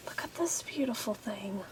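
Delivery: breathy awed whisper over something pretty; hushed whisper. Real, not acted. Vlogger whispering in awe at a tiny stamp. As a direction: whisper it like you don't want to disturb it.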